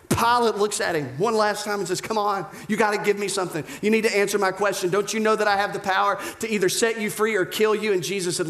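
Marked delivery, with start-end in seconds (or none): 2.0-7.9 fast, higher-pitched urgent appeal; urgent pressing. Acted. Pilate to Jesus one last time, urgent. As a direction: press for an answer in a hurry.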